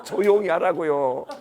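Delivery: drawn-out, laughing retold plea; comic hushing. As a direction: stretch out a mock-exasperated shushing. Official hushing the chief, comic.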